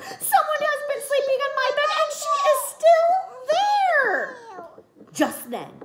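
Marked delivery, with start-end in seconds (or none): 0.0-4.4 very high, loud, fast squeal; snapping Baby Bear. A narrator's reading. Baby Bear snaps that Goldilocks is still in his bed. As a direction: yelp it out, indignant. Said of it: "And Baby Bear snapped".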